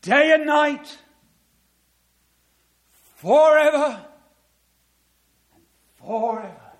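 loud, high ringing repeat of the verse; proclaimed again. Acted. Preacher driving the verse home, loud proclamation. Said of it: "Not even an atheist theologian could get round that".

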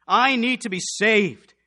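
loud, slow cry to be saved; desperate plea. Acted. Jailer waking to danger, crying loud and slow. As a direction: call it out slowly and loud.

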